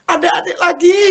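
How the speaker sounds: very high-pitched celebratory shriek; joyful yell. Retold or imitated. Husband mistakes wife's positive result for pregnancy, joyful yell. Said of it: "kok malah saya teriak".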